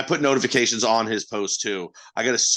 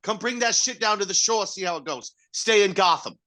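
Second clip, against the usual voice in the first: higher, hammed-up Jersey shore tough; mock wiseguy taunt; 7 semitones higher than usual. Italian tough taunts Batman to come to shore. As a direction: dare him to come down.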